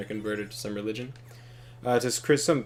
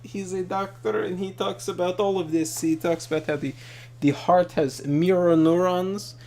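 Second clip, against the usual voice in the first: put-on higher, louder Russian-accented enthusiast; imitated Russian accent; 6 semitones higher, 4 dB louder than usual. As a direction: explain it earnestly in a mock Russian accent. Retelling her theory in her accent.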